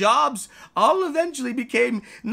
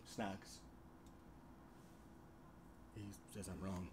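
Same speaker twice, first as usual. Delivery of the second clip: ordinary delivery, nothing marked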